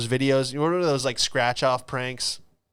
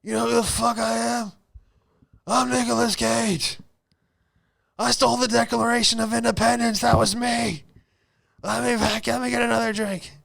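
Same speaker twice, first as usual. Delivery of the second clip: put-on high, loud drunken rant; a drunk celebrity bellowing at the bouncer; drunk yelling; 11 semitones higher, 4 dB louder than usual. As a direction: rant like a drunk star denied entry. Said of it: "He's yelling at her".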